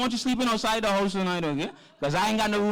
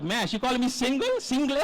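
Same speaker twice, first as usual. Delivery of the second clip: higher, incredulous mock outrage; mock indignant surprise; 6 semitones higher than usual. Host mocks his own shock at the poet's word solo. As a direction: act shocked at being called single. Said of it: "I was like".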